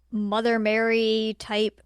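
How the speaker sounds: ordinary talk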